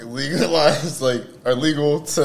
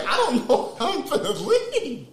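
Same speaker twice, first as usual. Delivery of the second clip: laughing panic; 12 semitones higher than usual. Retold or imitated. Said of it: "I was like".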